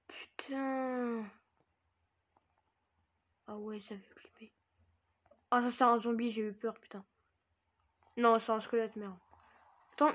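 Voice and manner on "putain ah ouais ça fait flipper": hushed, much softer whisper; real frightened whisper; scared whisper. Real, not acted. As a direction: breathe it out, spooked and barely voiced. Creeped out by the scene.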